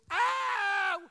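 high, held "ah" wail; imitated crying wail. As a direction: act out a man crying out. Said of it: "he's just crying".

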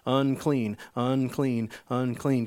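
ordinary talk

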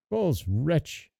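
false wretch, spat out by a stern king; a stern ruler scorning a traitor; angry sultan. A narrator's reading. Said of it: "said the Sultan".

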